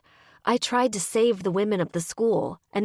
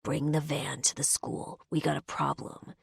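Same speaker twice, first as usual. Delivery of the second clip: rasped into phone; 2 semitones lower, 6 dB quieter than usual. Acted. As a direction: keep it hushed and rough. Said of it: "Zane rasps into the phone".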